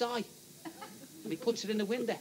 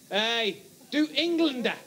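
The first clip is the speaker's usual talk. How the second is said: higher, louder, slow mock-German complaint; indignant German tourist; 4 semitones higher, 3 dB louder, slower than usual. German comes back to the shop owner, indignant mock-German accent. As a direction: tell the Englishman off in a comic German accent.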